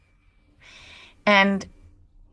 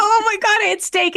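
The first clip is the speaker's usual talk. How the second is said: high, loud mock-horrified outburst; horrified confession; 11 semitones higher, 5 dB louder than usual. Caller confessing last night's dinner to mentor, horrified confession. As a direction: blurt it out, pitched way up.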